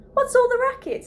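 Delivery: high, loud alarm; startled cry. A narrator's reading. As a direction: shout from the kitchen. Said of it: "Cindy cried".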